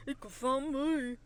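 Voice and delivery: slow, drawn-out country singer impression. Host doing her country singer impression after a countdown. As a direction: stretch the words like a country star. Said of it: "my impression of [name]".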